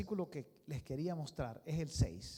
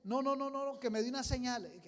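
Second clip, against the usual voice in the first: insistent; 7 semitones higher, 5 dB louder than usual. Acted. Hezekiah demanding a sign.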